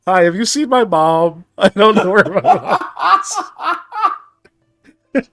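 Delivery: put-on lost kid. Lost child voiced, laughing.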